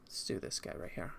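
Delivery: whispered to himself. Real, not acted. Streamer choosing the owl picture, whispering to himself. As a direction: breathe it out under your breath. Picking a reference photo.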